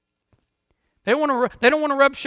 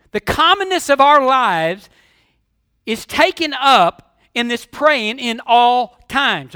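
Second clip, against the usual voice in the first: building, emphatic sermon delivery, a bit louder; full-force preaching; 7 dB louder than usual. Acted. Pastor urging the congregation to pray in ordinary things, preaching hard. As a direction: drive each point home to the church.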